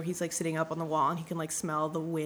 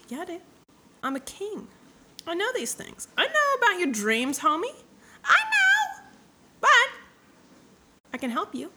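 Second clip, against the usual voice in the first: very high put-on old man voice, swelling to loud; hammed-up mystic king; 12 semitones higher, 5 dB louder than usual. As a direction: play a comically high-pitched all-knowing king.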